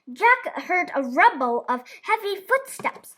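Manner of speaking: plain reading voice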